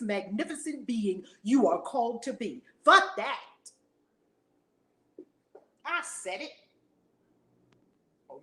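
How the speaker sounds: ordinary talk, nothing marked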